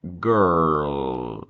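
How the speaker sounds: put-on "girl" drawn out long, pitch falling; pronúncia demonstrada. Shown as a demonstration. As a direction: model "girl" slowly for students. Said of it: "Ó, girl". ("girl" bem esticado e devagar)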